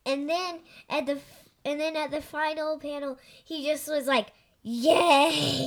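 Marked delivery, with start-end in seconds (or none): 4.6-5.7 loud Yay in a put-on voice; excited Yay. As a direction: shout Yay like Garfield seeing food. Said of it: "that's the only one in this that he's excited".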